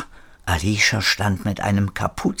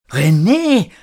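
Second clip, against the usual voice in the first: loud, higher-pitched call; 9 semitones higher, 6 dB louder than usual. A narrator's reading. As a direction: call the name out in recognition. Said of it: "rief Erik". (laut gerufen)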